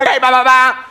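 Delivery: loud, high frantic babble; tripping out. Acted. Husband flagging down a cop, tripping out. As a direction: shout gibberish at the officer. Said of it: "I'm like, tripping out".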